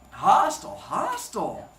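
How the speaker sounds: emphatic mock verdict; loud mock hostile. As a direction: bark the first hostile out, then let the second drop. Wry comment on wife's tone.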